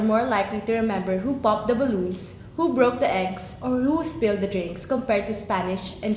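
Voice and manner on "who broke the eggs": ordinary talk, nothing marked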